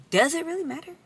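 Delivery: high-pitched sassy ask; sassy high question. Real, not acted. Rhetorical sass to viewers.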